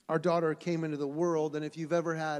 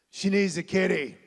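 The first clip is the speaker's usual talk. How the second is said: put-on louder, clear kid's pronouncement; lightly voiced little boy telling his parents what to do; firm little-boy declaration; 9 dB louder than usual. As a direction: say it like a stubborn small boy. Son's matter-of-fact announcement.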